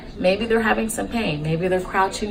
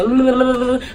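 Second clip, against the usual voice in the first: higher babbled imitation of fast Spanish; mock rapid gibberish; 6 semitones higher than usual. Mocking what people think Spanish sounds like, rapid babble. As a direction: babble nonsense like rapid speech. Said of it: "a lot of people think when you speak Spanish, they're just like".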